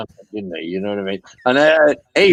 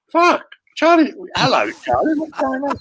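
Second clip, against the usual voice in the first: much higher, squealing greeting; surprised delight. Retold or imitated. [name] picks up Charlie's call, much higher excited greeting. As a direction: starstruck yelp on the phone. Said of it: "I went".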